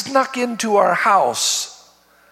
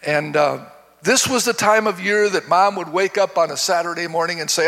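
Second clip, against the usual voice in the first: ordinary delivery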